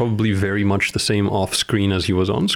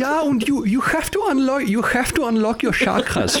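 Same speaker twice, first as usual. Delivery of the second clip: put-on high German-accented guru voice; hammed-up German guru on chakras; high German accent urging chakras; mock German guru. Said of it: "does fit with that is German".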